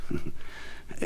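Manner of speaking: a voiceless breathy laugh; breathy silent laugh. Acted. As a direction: laugh under your breath. Mad narrator laughs.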